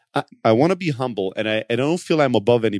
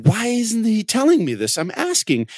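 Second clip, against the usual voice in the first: high-pitched exasperated whine, imitating his past self; mock frustration; 10 semitones higher than usual. A founder retelling his frustration with an employee, mock exasperated. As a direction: act out the frustrated boss. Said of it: "super frustrated about this and going".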